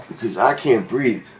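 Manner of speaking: usual speaking voice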